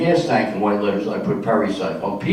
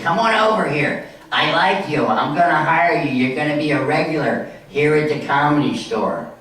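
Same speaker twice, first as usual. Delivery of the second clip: higher-pitched impression of a club owner; Mitzi; 4 semitones higher than usual. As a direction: imitate the club boss hiring you, pitched up. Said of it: "And she talks like this".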